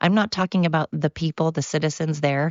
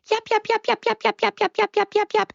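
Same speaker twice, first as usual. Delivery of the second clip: high, rapid little-dog yaps; yapping chihuahua. Retold or imitated. Small countries as a chihuahua yapping at a mastiff, high yaps. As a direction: yap like a tiny dog picking a fight. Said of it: "like the little chihuahuas".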